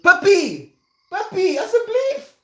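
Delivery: very high, loud shriek at a puppy; loud, squeaky exasperation; exasperated yell. Real, not acted. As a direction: call the dog off in a pleading squeal. Yelling at puppy mid-story.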